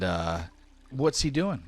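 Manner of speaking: ordinary delivery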